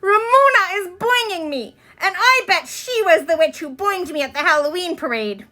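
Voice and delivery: high, whiny complaint; tattling girl. Acted. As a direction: tell on Ramona to the teacher. Susan tattling, near tears.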